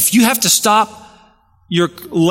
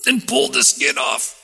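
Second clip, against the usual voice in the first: ordinary talk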